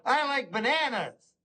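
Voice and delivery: high-pitched, simple delight; cheerful, guileless high voice; happy friend. Acted. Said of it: "a guy with Down syndrome, to go".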